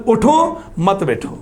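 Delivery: اٹھو high and rising, then a break before مت بیٹھو; pause after اٹھو. Shown as a demonstration. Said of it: "اب اٹھو کے آگے اردو زبان کے اندر بھی ایک نشان ہوتا ہے". (اٹھو پر زور اور وقفہ، پھر مت بیٹھو)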